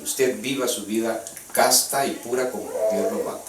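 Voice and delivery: normal talking voice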